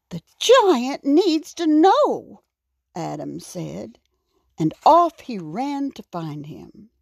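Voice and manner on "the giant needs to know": higher, emphatic little hero; lightly voiced resolute raccoon. A narrator's reading. Raccoon decides to find the giant, higher and determined. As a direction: make up your mind, pitched up.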